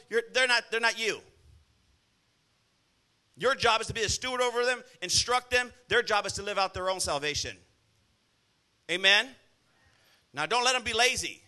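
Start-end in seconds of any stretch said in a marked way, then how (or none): none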